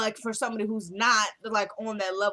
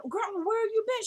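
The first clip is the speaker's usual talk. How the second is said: put-on very high little-boy voice; Quincy Junior; 14 semitones higher than usual. Little boy asking Grandma where she was, high child voice. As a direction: imitate a small child questioning Grandma. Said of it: "she sees Quincy Junior".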